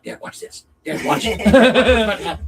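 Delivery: hushed first, then a loud slow drawn-out call; calling dad to watch the fight; urgent whisper building to shout. Retold or imitated. Son urging his dad to watch the brawl, whisper rising to laughing shout.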